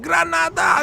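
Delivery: high-pitched, hammed-up bandit cry; mock bandit yell. Host imitating game bandits throwing grenades, high mock yell. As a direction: squeal it like a panicked bandit lobbing a grenade. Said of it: "They're like".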